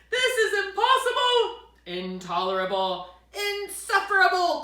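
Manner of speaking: high, loud exasperated outbursts; furious cry. Acted. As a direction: shake with anger on each word. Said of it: "cried Bear, shaking with anger".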